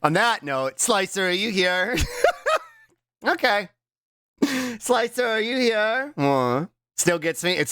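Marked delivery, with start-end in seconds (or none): 2.0-2.6 a quick, high, shrieking laugh. Real, not acted. Laughing at the clip, a high squeal.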